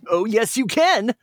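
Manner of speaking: high, bright-pitched hero voice; Sonic voice. Acted. Sonic turning to the fox who called him, high cartoon hero voice. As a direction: answer like a cocky cartoon hero. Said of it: "Sonic said".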